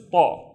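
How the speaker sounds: "ta" with a thick, backed Arabic T; heavy emphatic ṭa. Shown as a demonstration. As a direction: say "ta" dark and heavy, vowel deepened toward "taw". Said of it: "a heavier version of ta".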